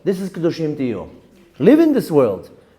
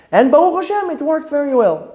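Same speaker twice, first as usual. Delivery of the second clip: higher, louder thankful burst; excited praise; 10 semitones higher, 4 dB louder than usual. Real, not acted. Rabbi praising God that his plan worked, excited. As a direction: lift up with gratitude.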